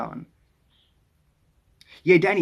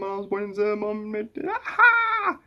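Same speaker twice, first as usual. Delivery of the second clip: building excitement; 6 semitones higher, slower than usual. Real, not acted. Streamer reads viewer's family story, excitement building. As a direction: read with rising pitch until it bursts out. Reading comment, building to a squeal.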